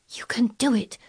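high, whispered urging; to herself. A narrator's reading. Mary willing Colin on, whispering to herself. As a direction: whisper it intently under the breath. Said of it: "said Mary to herself".

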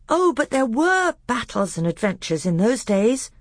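put-on high, eager little boy; child protesting excitedly; Caspian.

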